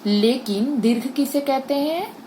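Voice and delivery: ordinary delivery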